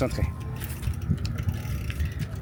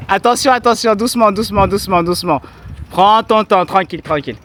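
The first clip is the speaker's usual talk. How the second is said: coaxing encouragement; 6 semitones higher, 4 dB louder than usual. Real, not acted. Guiding friend on bike.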